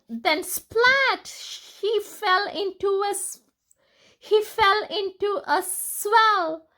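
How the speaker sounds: bright, high read-aloud narration with rising excitement; lively storytelling. A narrator's reading. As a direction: read it like an exciting picture book.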